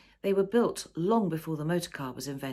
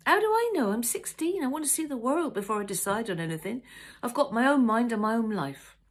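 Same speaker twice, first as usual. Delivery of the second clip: much higher, louder youth; teenage outburst; 6 semitones higher, 6 dB louder than usual. Acted. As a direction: burst out like a frustrated teen. Son bursts out.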